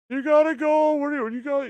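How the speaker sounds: high, loud mock Indian accent; hammed-up Indian accent; bad Indian accent. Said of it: "I'm terrible at, uh, Indian accents".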